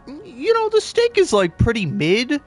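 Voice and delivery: snooty diner voice. Retold or imitated. Voicing a picky restaurant diner.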